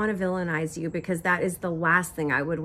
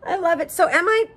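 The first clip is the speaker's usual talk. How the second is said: excited; 13 semitones higher than usual. Real, not acted. Still laughing, then asking forgiveness.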